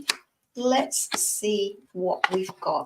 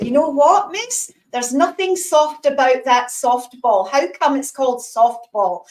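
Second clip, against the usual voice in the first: put-on loud, high-pitched Scottish accent; 4 semitones higher, 5 dB louder than usual. Newcomer complaining to the teacher about softball, Scottish accent. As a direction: protest in an indignant little Scottish accent. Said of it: "in my little Scottish accent".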